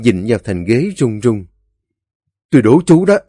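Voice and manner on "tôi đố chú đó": raised, high angry dare; hot-tempered Bằng. A narrator's reading. Bằng loses patience and dares Ba Xị, angry. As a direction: throw down a challenge in anger. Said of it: "Bằng nổi nóng".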